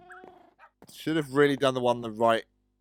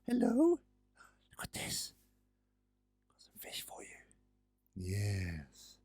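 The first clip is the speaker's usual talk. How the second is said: coaxing whisper; 2 semitones lower, 6 dB quieter, much slower than usual. Real, not acted. Offering the cat fish in a whisper. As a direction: lure the cat gently, almost no voice.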